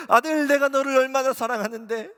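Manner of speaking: loud, high fervent plea, stress on 내가; Jesus calling his son back with rising emotion; the first of three swelling cries, starting on 내가; Jesus pleading. Retold or imitated. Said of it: "주님이 이렇게 말씀하셨어요".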